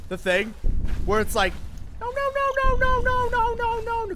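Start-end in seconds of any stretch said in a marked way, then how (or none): 2.0-4.1 very high squeaky "no no no"; cat meme voice. Retold or imitated. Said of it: "It's like that cat meme".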